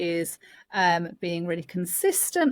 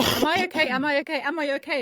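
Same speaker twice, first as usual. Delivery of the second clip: higher, pleading little voice asking again and again; anxious baby voice; 9 semitones higher, faster than usual. Pretend. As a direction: voice a worried baby checking in. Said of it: "your baby is asking you a question".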